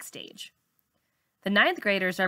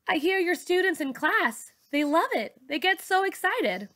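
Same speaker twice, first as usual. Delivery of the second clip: much higher, bright and enthusiastic; Ty's voice; 10 semitones higher than usual. Acted. Ty telling Miss Saunders her students love class, high and excited. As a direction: gush a little about the kids.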